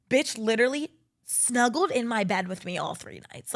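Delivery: ordinary delivery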